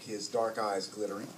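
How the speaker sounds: plain reading voice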